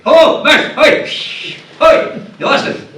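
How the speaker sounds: put-on loud, high child call-outs; pupils shouting. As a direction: yell for the teacher's attention. Kids calling out to teacher.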